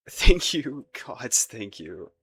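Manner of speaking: loud burst of gratitude, easing off; overjoyed relief. Acted. Suitor thanking the beloved for allowing courtship, overjoyed. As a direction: gush thanks, then let it slow with relief. Courtship has just been accepted.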